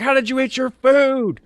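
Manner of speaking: high, loud demanding yell; drill sergeant yell. Retold or imitated. Drill sergeant grilling the recruit, loud yell.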